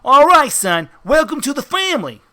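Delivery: welcoming mobster. Acted. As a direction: welcome a new recruit like a mob heavy. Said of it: "And then he grabs your face".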